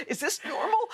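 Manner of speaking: a very high, squeaky "Is this normal?" through a laugh; laughing disbelief. Retold or imitated. Tearing up in the studio, very high laughing question.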